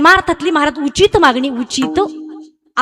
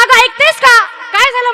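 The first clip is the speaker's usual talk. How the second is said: calling out to wife; 8 semitones higher, 3 dB louder than usual. Acted. Husband arrives calling his wife.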